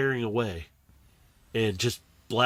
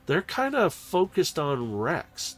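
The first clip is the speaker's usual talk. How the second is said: ordinary delivery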